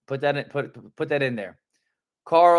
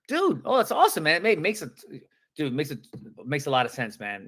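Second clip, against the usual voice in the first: excited; faster than usual. Shown as a demonstration. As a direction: gush with happy surprise. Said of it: "You wanna sound excited".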